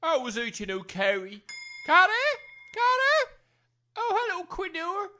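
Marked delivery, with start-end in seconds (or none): 1.9-3.3 high, loud call across the house. Acted. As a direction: shout for Carrie. Calling out for his wife.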